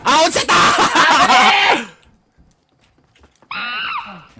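high, long-drawn laughing shout; laughing cry of alarm. Real, not acted. Player tells teammate they fell, laughing shout. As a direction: wail it out through laughter.

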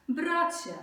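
high, loud call. Acted. Brahmin calls the crocodile in the mud, loudly. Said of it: "zawołał go Bramin".

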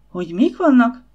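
can't believe what she just heard; scandalised housekeeper gasps at the news; shocked disbelief. Acted.

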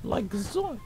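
high, lightly voiced Shaggy exclamation; a hint of a scared cartoon stoner; Shaggy impression. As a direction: throw in a goofy cartoon yelp. Shaggy catchphrase.

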